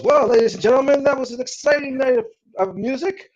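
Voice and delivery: high-pitched, projected announcer voice; event announcer. Acted. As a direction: open big and high, like an MC addressing the hall. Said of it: "You see the announcer come up".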